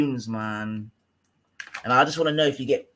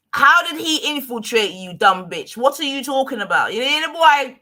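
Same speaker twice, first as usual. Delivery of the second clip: high, loud furious outburst; angry shout; 8 semitones higher, 5 dB louder than usual. Real, not acted. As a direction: yell at her in disbelief. Furious rant at rival streamer.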